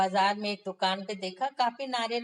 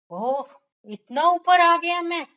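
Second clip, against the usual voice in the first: startled cry, much higher and louder; frightened; 9 semitones higher, 9 dB louder than usual. Acted. Miser on top of the tree, suddenly frightened by the height. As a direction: gasp in fear at the height. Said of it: "डर गया".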